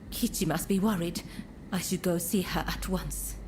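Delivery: ordinary talk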